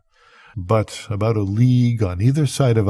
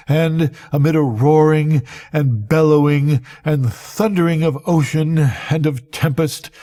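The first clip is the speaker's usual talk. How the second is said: building panic, pitched higher and higher toward the end; rising terror; 4 semitones higher than usual. Acted. Narrator swept into the whirlpool, voice climbing in terror. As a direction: let the dread climb as the ship goes down.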